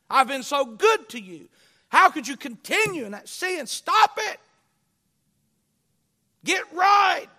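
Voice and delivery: loud, high, building to a shout; impassioned exhortation climbing to shouted commands; full-force preaching. Real, not acted. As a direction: thunder God's rebuke from the pulpit. Preaches at full force, voicing God.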